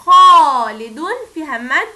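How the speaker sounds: خالد loud and slow, then فيها مد plain; stressing the key word for pupils. Shown as a demonstration. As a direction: hit خالد hard. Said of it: "عرفنا ان كلمة".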